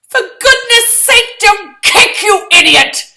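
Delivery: high, loud, slow angry scold; furious scolding. Acted. Girl scolding her clumsy partner, loud angry. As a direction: snap at him in outrage.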